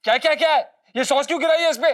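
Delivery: high, insistent demand; angry scolding. Acted. Scolding the watchman over what is on the uniform, angry questioning. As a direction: pounce on the offence with repeated questions.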